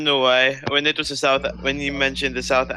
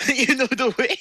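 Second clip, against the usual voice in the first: laughing, higher mock Ugandan Knuckles meme voice; 12 semitones higher than usual. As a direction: giggle through a silly VR chat meme voice. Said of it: "that fucking V R chat shit".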